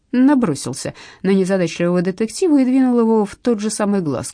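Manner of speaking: usual speaking voice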